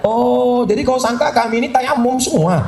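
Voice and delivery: high, sarcastic comeback; lightly voiced sassy retort; mock retort. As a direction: tease back like a miffed listener. Audience member retorting.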